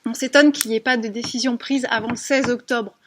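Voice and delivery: ordinary talk, nothing marked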